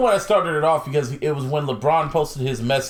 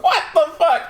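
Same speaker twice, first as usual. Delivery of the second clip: high-pitched, laughing exclamation; incredulous squeal through laughter; laughing disbelief; 13 semitones higher, 3 dB louder than usual. Retold or imitated. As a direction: crack up in mock disbelief. Amazed reaction to the nonchalance.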